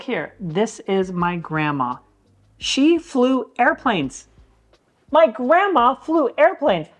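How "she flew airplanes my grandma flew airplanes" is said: higher, louder and slow with proud wonder; amazed. Real, not acted. Grandson showing his pilot grandma's photo, amazed and proud. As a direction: let the amazement swell on airplanes.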